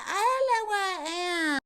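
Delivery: whiny, crying damsel voice; mock helpless whimper. Stranded woman whimpers to the hero, mock helpless cry. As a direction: ham up being lost and helpless.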